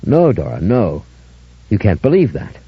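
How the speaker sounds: plain reading voice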